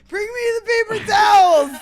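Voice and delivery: high, loud comic demand; laughing call for rescue; mock desperate shout. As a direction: cry out as if drowning, hammed-up. Acting out a plea from the bed.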